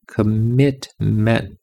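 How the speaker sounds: very slow, stretched commitment; "Commitment" spoken very slowly with every sound drawn out. Shown as a demonstration. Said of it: "same word slowed right down".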